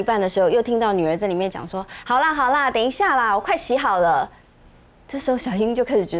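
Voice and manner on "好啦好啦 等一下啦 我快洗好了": calling out. Retold or imitated. Daughter calling from the bathroom.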